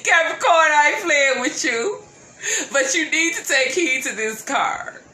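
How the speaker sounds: loud, high, laughing tease; laughing warning. Real, not acted. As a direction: warn them while laughing.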